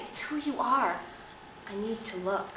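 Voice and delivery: soft, intimate reassurance; tender insistence. Acted. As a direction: quietly persuade a shy lover. Bob's tender insistence, acted.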